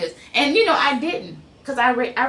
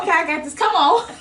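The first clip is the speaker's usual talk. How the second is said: excited; 7 semitones higher, 5 dB louder than usual. Retold or imitated. Retold confident pep talk.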